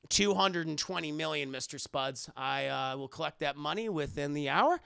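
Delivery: low, composed demand for payment; understated, low-voiced menace; cool creditor. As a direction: state the debt low and businesslike. Creditor coolly collects a bet.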